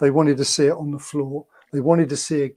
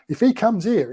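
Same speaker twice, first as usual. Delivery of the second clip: mild rousing pep talk; 6 semitones higher, 3 dB louder than usual. Retold or imitated. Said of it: "really geeing us all up".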